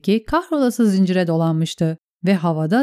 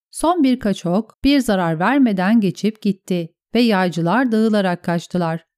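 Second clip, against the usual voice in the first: ordinary delivery, nothing marked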